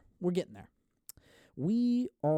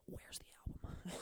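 whisper; 20 dB quieter than usual. Pretend. Aside to the band singer.

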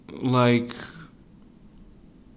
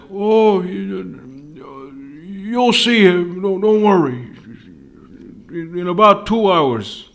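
high, loud mock-reassuring grandpa; grandpa's reassurance; 6 semitones higher, 6 dB louder, slower than usual. Grandpa fobbing off his grandchild about the lamb, loud mock reassurance. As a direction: draw it out loudly, brushing off the worry. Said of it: "It was like".

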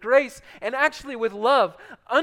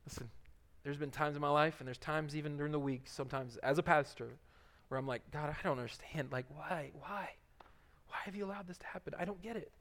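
hushed confession; 8 semitones lower, 16 dB quieter, faster than usual. Acted. Quiet confessional prayer voice.

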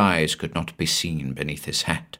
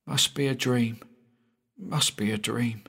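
soft, higher-pitched self-reassurance; murmured to himself; 5 semitones higher, 7 dB quieter than usual. A narrator's reading. Shaken boy repeating to himself before sleep, softly. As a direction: say it quietly, as if to yourself. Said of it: "he repeated to himself".

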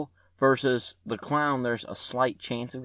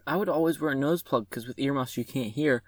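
ordinary delivery

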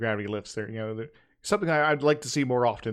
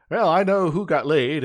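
louder, knowing smirk; smug aside; 3 semitones higher, 7 dB louder than usual. Retold or imitated. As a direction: say it with a sly grin. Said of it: "I just was like".

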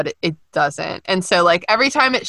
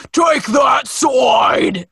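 orc voice; 5 semitones higher, much slower than usual. Acted. Host mimicking Marcus Flint from the film, orc voice. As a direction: bellow it slowly like a movie orc. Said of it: "that is an actual orc voice".

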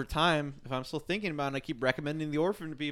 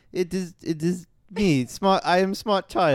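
higher, loud put-on little-girl orphan; orphan impression; 3 semitones higher, 8 dB louder, slower than usual. Host closing out with an orphan impression, put-on child voice. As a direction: play the orphan, slow start then louder. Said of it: "do an impression of the orphan".

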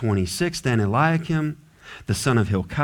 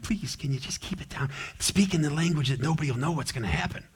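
much softer, hurried hushed request; hushed plea; 9 dB quieter, faster than usual. Retold or imitated. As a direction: ask them to keep it down, almost under the breath. Envoys asking him to lower voice.

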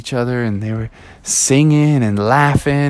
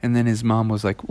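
ordinary delivery, nothing marked

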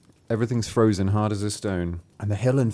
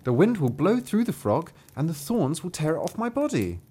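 put-on a touch of a girl, higher and pleading; upset girl; 6 semitones higher than usual. A narrator's reading. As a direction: protest lightly as the cold young girl. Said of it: "said the girl".

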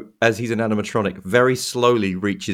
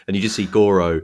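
ordinary talk

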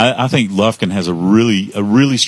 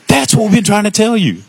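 mock coach yell; 13 semitones higher, 3 dB louder than usual. Coach voiced in retold story.

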